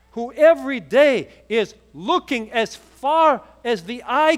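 rising, loud sermon; preacher driving home the point, slowing on the last lines; full-force preaching. Real, not acted. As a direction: build the sermon to a passionate peak, loud. Preaching climax.

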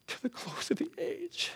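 very soft, slow, high voice; hushed ending. Retold or imitated. Jesus' promise to the disciples closes, hushed and slow. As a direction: let it float out high and hushed.

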